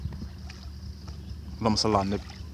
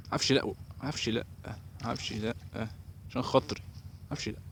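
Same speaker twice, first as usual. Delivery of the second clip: soft, anxious begging; quiet pleading; 9 dB quieter than usual. Real, not acted. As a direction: plead softly, almost to yourself. Quietly pleading as it may snag. (توسل بصوت واطي)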